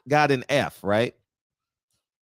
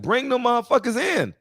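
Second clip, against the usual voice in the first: high, eager repeated demand; pitched-up mock cheer; fired-up retort; 9 semitones higher than usual. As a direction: cheer them on to send troops in. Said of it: "I was like".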